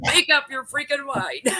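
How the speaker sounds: high, drawn-out impatient demand; mock exasperation. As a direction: play fed up with their dithering. Acted quarrel over choosing food.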